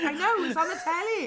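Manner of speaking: mock exasperation. Podcaster acting out telling someone it's on telly, mock exasperated.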